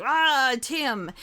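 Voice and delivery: hammed-up attacker's cry; mock battle yell. Storyteller voices a guy coming at Tim, mock menacing yell. Villain charges at the hero.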